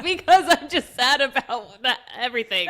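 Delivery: high, loud wail through laughter; hammed-up weepy whine; mock crying. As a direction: send up your own tearful outburst, laughing. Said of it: "after me crying".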